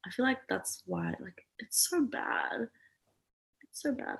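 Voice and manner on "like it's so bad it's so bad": breathy whisper, slowed right down. Real, not acted. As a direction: hush it to a whisper. Whispered complaint.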